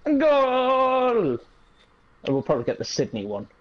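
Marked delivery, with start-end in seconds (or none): 0.1-1.3 high, long football commentator's goal call; drawn-out goal cry. Pretend. Player shows what he likes saying, drawn-out goal cry. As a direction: hold the vowel like a match commentator. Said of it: "You know what also I like saying?".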